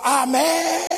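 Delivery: high, drawn-out mock congregational cry; church's mock shout. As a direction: imitate a whole church calling out. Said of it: "toda la iglesia gritaba".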